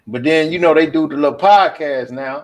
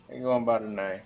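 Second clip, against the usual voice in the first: repeated much softer; quiet repeat; 7 semitones lower, 14 dB quieter than usual. Real, not acted. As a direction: echo it under your breath.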